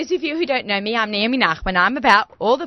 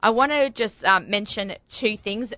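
ordinary delivery

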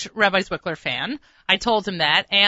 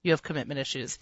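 low, soft dry put-down; lightly voiced conductor's deadpan jab; mild mock deadpan diagnosis; deadpan quip; 6 semitones lower, 9 dB quieter than usual. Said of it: "he looks at me and goes".

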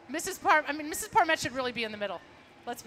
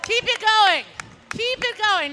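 cheering; 9 semitones higher, 8 dB louder, slower than usual. Real, not acted. Cheering marchers at a parade.

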